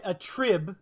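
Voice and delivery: "a trib" drawn out, weight on TRIB; slow down and land on "trib"; stress on TRIB. Shown as a demonstration. Agreeing on the verb's stress, slow emphatic "a TRIB". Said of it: "your trib is the emphasis".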